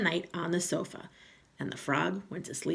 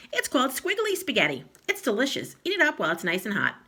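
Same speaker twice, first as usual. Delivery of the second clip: sweetly sly; 7 semitones higher, 5 dB louder, faster than usual. Acted. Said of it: "Missus Twit".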